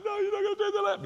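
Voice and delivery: put-on high, fast child's protest; boy pleading to keep the lamb, tearful; crying little boy. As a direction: wail it like a boy losing his pet. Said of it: "little boy starts crying".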